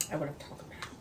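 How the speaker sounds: hushed whisper of pleasure after a taste; whispered delight. Real, not acted. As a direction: breathe it out like a secret, savoring the food. Tasting greens, savoring.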